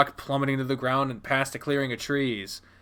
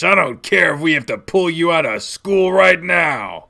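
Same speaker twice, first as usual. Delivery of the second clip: loud, raised ultimatum; angry threat; 4 semitones higher, 6 dB louder than usual. Acted. Dad threatening to pull child from school, angry ultimatum. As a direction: lay down the punishment forcefully.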